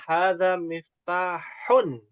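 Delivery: hadza miftahun read slowly with the -un ending; full reading. Shown as a demonstration. Teacher models hadza miftahun slowly, ending included.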